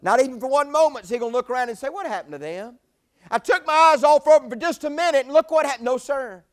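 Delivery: loud, high proclaiming; full-force preaching. Acted. Preacher telling the church God never looks away, loud. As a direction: ring it out over the room.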